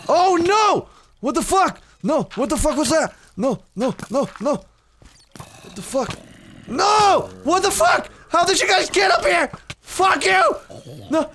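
high, loud panicked screaming; screaming in real panic. Real, not acted. Gamer screaming at mobs ambushing him, high panicked shrieks. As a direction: shriek it like you're being swarmed.

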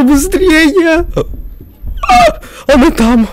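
panicked laughing shout; high frantic yelp; being chased. Real, not acted. Fleeing the monster.

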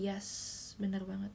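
usual speaking voice

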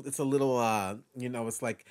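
usual speaking voice

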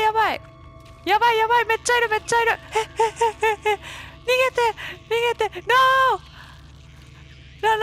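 loud, high terrified screaming; being chased. Real, not acted. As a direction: shriek in full panic. Chased by many creatures.

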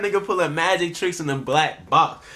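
high, excited hype; hyped up. Real, not acted. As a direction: hype the artist up. Hyping up the artist.

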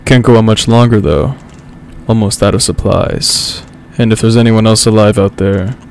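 deep sexy narrator voice. Pretend. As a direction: sink the voice down for the dramatic close. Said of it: "read this in my deep, sexy narrator voice".

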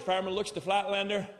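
ordinary talk, nothing marked

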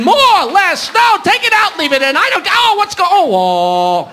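high, breaking-off cries of confusion; mock screaming. Patient in the gynecologist joke, mock screaming in confused pleasure. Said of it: "She's screaming".

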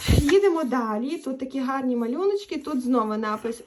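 usual speaking voice

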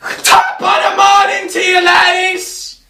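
high, loud, shouted hello; over-the-top high shout; yelled greeting. Acted. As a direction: bellow the morning greeting to the ladies.

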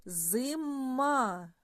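Зима with a long, hummed М in the middle; third sound stretched. Shown as a demonstration. Teacher asking for the next sound, М stretched. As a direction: hold the М in Зима. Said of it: "Який наступний звук".

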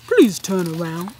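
detective commanding the suspect; stern detective demand; firm request. Acted. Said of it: "he said when he got to the last suspect".

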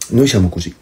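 ordinary talk, nothing marked

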